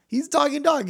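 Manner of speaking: high-pitched acted insistence; insistent protest. Joke's dog owner insists.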